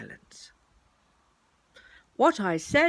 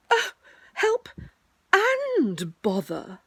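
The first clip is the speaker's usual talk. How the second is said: high cry, then drawn-out complaint; exasperated distress; 12 semitones higher, 5 dB louder, much slower than usual. Acted. Pooh stuck fast in the hole, exasperated. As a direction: cry out, then drag the words in frustration.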